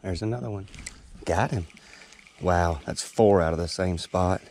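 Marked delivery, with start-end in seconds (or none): none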